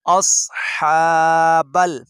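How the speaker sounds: अस्हाबल with a full, heavy स्वाद, not सीन or सा; अस्हाबल drawn out, the स्वाद heavy and emphatic; heavy saad in ashab. Shown as a demonstration. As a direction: stretch अस्हाबल slowly, hitting the emphatic स्वाद. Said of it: "अस आगे स्वाद है, सीन नहीं पढ़ना".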